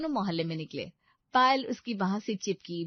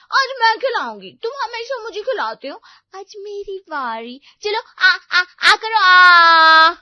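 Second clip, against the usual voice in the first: high, loud feeding demand; insist, ending on a long loud "aah"; playful, high-pitched bossy coaxing; pushy doting girlfriend; 11 semitones higher, 16 dB louder, slower than usual. Acted. Voices Payal insisting on feeding.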